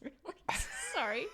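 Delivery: usual speaking voice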